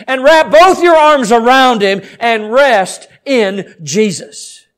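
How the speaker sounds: ringing sermon appeal, hard on key words; full-force preaching. Real, not acted. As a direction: preach it urgently to the congregation.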